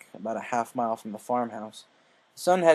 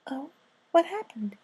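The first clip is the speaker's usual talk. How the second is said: Fluttershy voice; 13 semitones higher than usual. Acted. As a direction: speak like a shy gentle mare.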